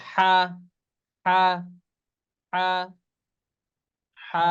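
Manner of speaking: হা with the h pushed from deep in the throat; from deep inside. Shown as a demonstration. Teacher has everyone repeat, হা from deep inside. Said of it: "ভিতর থেকে, এই যে".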